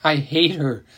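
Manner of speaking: ordinary delivery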